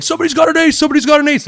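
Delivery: high, excited repeated cry; imitated giddy celebration; Nick, excited. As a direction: squeal it like an overexcited friend. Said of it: "he's an excitable type".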